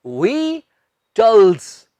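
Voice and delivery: विटल्स very slowly in two parts, VI - TULZ; syllable by syllable. Shown as a demonstration. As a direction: break विटल्स apart with a pause. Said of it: "इसका सही उच्चारण है".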